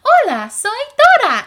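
high, loud cartoon greeting; Dora. Showing what people say she sounds like, Dora voice. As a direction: call it out like a kids' show heroine. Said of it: "people tell me that I sound like".

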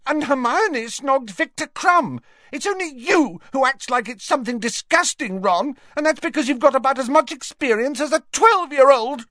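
high, loud, upset tirade; shouting, near tears. Acted. Ginny lashing out at her brother, shouting near tears. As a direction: hurl it at him, close to tears. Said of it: "who sounded close to tears now".

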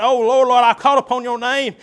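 loud imitated plea; pleading sinner. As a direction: beg like a desperate sinner. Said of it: "a lot of people say".

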